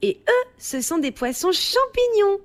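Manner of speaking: put-on high-pitched childlike exclamation; excited discovery in a high child voice; excited child's cry. A narrator's reading. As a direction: cry out like a thrilled little one. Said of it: "s'écrie Choupi".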